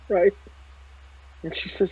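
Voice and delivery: ordinary talk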